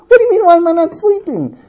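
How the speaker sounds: high-pitched, incredulous comeback; exasperated retort. Acted. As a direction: repeat the question in outraged disbelief. Repeat of retort, escalated.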